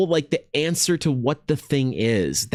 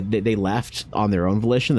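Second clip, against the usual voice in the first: ordinary delivery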